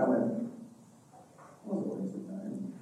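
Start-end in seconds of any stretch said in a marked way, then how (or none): 1.6-2.7 muttered under the breath; whispered thought. Retold or imitated. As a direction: whisper it to yourself, deflated. Private thought after student left.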